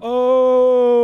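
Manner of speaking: surprised oh. Real, not acted. Reacting to the pull-up video.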